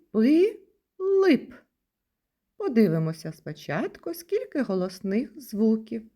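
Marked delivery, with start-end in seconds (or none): 0.1-1.5 "пилип" in two beats, with a pause after "пи"; high, louder "пи", then "лип"; split into syllables. Shown as a demonstration. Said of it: "скільки складів у слові".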